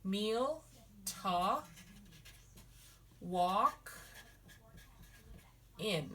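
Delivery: each word set apart with long pauses; syllable by syllable. Shown as a demonstration. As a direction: break "Meal to walk in" into slow separate words. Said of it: "it's a meal".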